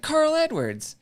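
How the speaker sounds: very high, loud holler; big drawn-out imitated yell; retold yell. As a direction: holler the name from the car window. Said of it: "and she yells".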